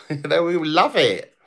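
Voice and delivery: ordinary talk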